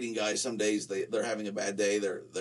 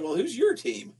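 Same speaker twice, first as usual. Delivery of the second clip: retold question; 13 semitones higher, 4 dB louder than usual. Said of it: "I said".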